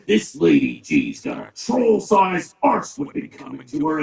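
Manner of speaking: normal talking voice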